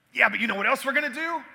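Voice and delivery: high, loud, excited pitch; retold fired-up enthusiasm; caught up in the moment. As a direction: get carried away with a big idea. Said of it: "got caught up in the moment".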